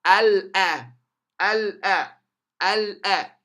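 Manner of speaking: ألا with a clear, loud L before the vowel; lam pronounced. Shown as a demonstration. Teacher showing alif lam plus alif, L not dropped. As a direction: sound the L of ألا firmly. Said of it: "You pronounce it beautifully".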